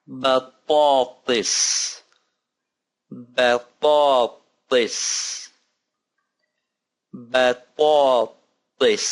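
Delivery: "Batatis" drawn out very slowly; slow model word. Shown as a demonstration. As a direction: stretch "Batatis" syllable by syllable. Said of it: "model word repeated slowly".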